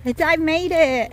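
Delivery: high and loud; excited shout. Real, not acted. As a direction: cheer it out in relief. Repeats arrival to Lenny.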